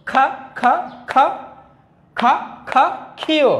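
high, even খা beats, then falling ক্ষীয়; sounding out aloud. Shown as a demonstration. Teacher repeats for pupils, letter sound drill. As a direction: call out খা in beats, finish on ক্ষীয়. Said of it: "আবারও বলছি".

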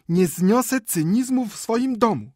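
very high, loud, slow indignation; scandalised wife's shriek; Maria Michajłowna crying out. A narrator's reading. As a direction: declare moral outrage at full pitch. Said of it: "krzyknęła Maria Michajłowna".

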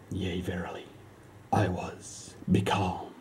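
hushed, slow, dreamy; becalmed whisper. Acted. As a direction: breathe it out serenely. After God's calming touch.

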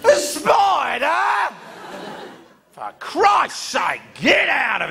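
put-on old docker shouting in slow disbelief; incredulous roar. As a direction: bellow it in outraged disbelief. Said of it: "He goes".

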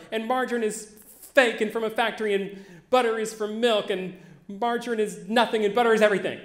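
high, loud, tumbling indignant list; furious little kid laying out the case; child's outraged rant. Retold or imitated. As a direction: pour out every grievance like a wronged kid. Child ranting at mother about butter.

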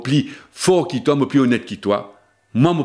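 ordinary talk, nothing marked

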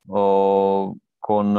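ordinary talk, nothing marked

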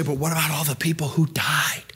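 hushed, doubting whisper; whispered objection. Retold or imitated. Imagined objector's doubtful question.

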